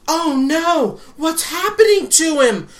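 high, loud, drawn-out theatrical alarm; mock panic. Skit girl calls out to the con floor in mock panic. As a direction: wail it like a hammy flash-mob actress.

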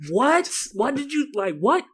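high, loud, incredulous what; acted-out loud confusion; blunt loud response. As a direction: demand a repeat at high pitch. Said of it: "I'm literally, I will".

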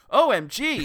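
loud high OMG; mock excited. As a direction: gush it like clickbait. Mock clickbait exclamation.

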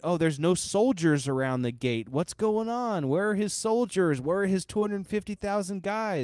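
high-pitched puzzled questions, one after another; mock bewilderment. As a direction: voice the rattled general's mounting confusion. Voices a baffled enemy general's thoughts.

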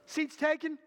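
ordinary talk

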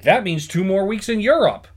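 high, swooping delight as the dad; mock excited dad. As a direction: imitate a dad thrilled by his windfall. Acting the dad's delight at news.

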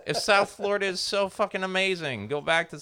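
loud high exasperated yell. Retold or imitated. Yelling at the Miami guy to go home. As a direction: blast him with it, fed up. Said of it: "And finally, I'm like".

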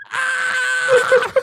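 very high imitated shriek; high drawn-out mock scream; mock terrified scream. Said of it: "her face was like she was terrified".

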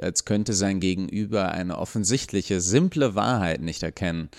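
usual speaking voice

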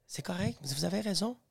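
very soft, placating agreement; meek retold reply. Father backing down before the educator, meek and hushed. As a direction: give in very quietly.

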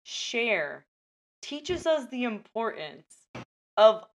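dramatic, paused movie-trailer read; trailer voiceover. Acted. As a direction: sell it like a trailer.

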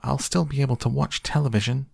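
high, quick squeaky voice; Mike. A narrator's reading. Shrunken boy insisting to his parents, tiny squeak. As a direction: pipe it up fast, tiny and high. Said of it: "squeaked the tiny voice of Mike T V".

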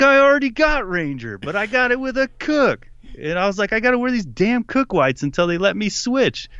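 high, strained complaint, acted out; exasperated protest. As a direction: protest like you've been cheated. Retold protest over cook contract.

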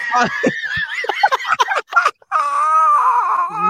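long, high, squealing laugh; shrieking laughter. Real, not acted. Podcast host loses it over a ridiculous tagline, shrieking laughter. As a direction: crack up into real shrieks.

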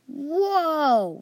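big surprised exclamation; amazed whoa. Acted. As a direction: gasp it out in wonder. Cave opens, all amazed.

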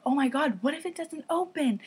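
high-pitched, mildly panicked worry; retold freak-out. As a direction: let the pitch climb with mock alarm. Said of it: "I'm freaking out".

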